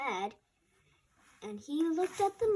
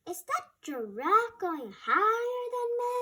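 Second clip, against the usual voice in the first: high, loud squawky owl; 11 semitones higher, 5 dB louder than usual. Acted. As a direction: hoot it out high and amazed. Owl voiced amazed.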